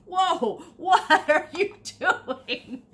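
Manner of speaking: cartoonish cat gasping at the fuss; mock cat voice. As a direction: squeal it like a puzzled cat with a laugh breaking through. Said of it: "the cats are like".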